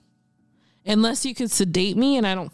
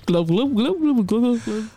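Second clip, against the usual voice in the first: gurgling fish man croak; 4 semitones higher than usual. Pretend. Imitating a fish talking.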